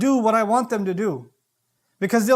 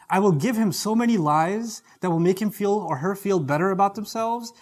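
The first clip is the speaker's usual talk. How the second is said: normal talking voice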